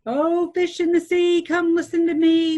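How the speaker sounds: loud, high call across the water. Acted. Fisherman calls to the magic fish at sea, loud high call. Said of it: "The man called".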